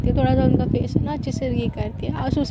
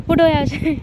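hammed-up louder scold; louder mock shout; 3 semitones higher, 6 dB louder than usual. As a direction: repeat उठो यहाँ से louder, almost a shout. Repeat, louder.

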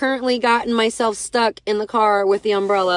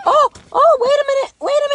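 much higher and louder, a sudden realization; sudden surprise; 13 semitones higher, 5 dB louder than usual. Real, not acted. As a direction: jump up in pitch as it dawns on you. Sudden idea while trapped.

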